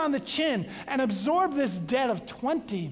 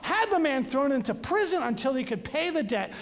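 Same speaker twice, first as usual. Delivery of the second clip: plain reading voice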